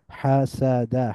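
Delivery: হাসাদা slowly as ha, sa, da; syllable by syllable. Shown as a demonstration. Teacher breaking down the word, হাসাদা syllable by syllable. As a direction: split হাসাদা into three drawn-out syllables. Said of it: "একটু মিলিয়ে মিলিয়ে পড়ব".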